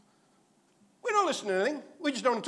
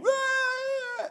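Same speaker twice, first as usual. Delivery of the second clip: put-on child bellowing wail. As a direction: bellow like a kid in a tantrum. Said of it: "this kid bellowing".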